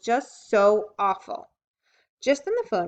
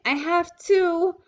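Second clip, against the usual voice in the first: put-on much higher, slow child's plea; urgent little girl; 10 semitones higher, 7 dB louder, much slower than usual. A narrator's reading. Ramona begging Mother to let her go, high and drawn out. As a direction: drag each word like a begging kid. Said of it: "insisted Ramona even more urgently".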